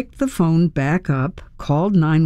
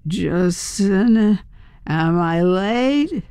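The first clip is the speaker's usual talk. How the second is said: put-on slow, slurred drunk woman; drunk slurring; 2 semitones higher, much slower than usual. A narrator's reading. Drunk stranger mistakes who woke her, slow drunken slur. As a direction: drag the words out in a boozy stupor. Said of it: "She was drunk".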